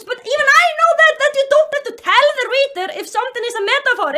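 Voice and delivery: high, loud rant at the author; exasperated yelling. Real, not acted. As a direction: yell it in outraged disbelief. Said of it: "when I yell".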